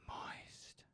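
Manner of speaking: breathy whisper, no voice; whispered catchphrase. Acted. Streamer teasing chat with a whispered catchphrase. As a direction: breathe the word right into the mic.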